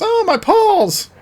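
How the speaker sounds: very high, drawn-out mock wail; hammed-up pained squeal; Mama Bear in pain. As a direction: cry out slowly as if smacked, half laughing. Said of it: "and she goes".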